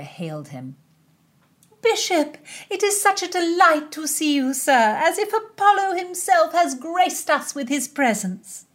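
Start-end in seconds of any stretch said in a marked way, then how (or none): 1.8-8.7 high, bright-eyed gushing princess; princess's flattering voice. Acted. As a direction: pour the praise on thick, up high.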